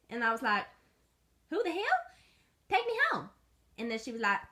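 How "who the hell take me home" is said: high, squeaky little dog. Acted. Little dog protesting to owner, high squeaky. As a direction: squeak it out indignantly.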